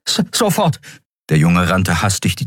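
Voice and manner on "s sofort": put-on high-pitched boy, tripping on the first sound; high, stammering young helper; stammered eager reply. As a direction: jump to obey with a stutter. Startled boy obeys an order.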